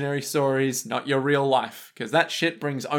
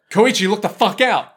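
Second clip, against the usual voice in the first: louder, higher urgent shout; warning yell; 5 semitones higher, 5 dB louder than usual. Retold or imitated. Josuke warns Koichi about the car, mock shouting. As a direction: yank a friend back from the road. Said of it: "Josuke's like".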